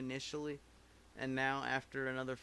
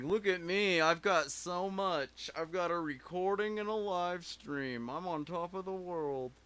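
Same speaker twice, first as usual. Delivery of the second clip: loud, higher, boastful show-off voice; cocky; 5 semitones higher, 5 dB louder than usual. Pretend. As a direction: brag like you own the world. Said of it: "I'm just being cocky now".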